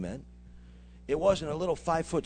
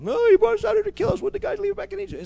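high, fast mock complaint; whiny murmuring; 14 semitones higher, 6 dB louder, faster than usual. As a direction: whine like a grumbling Israelite. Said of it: "y'all started murmuring".

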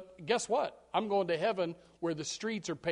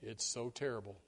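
gloomy outlook; 8 semitones lower, 9 dB quieter than usual. Shown as a demonstration. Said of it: "That's your outlook".

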